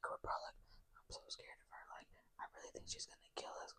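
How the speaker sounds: barely audible, secretive whisper; scared whisper. Retold or imitated. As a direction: whisper it like she might overhear. Secretly confiding fear in the room.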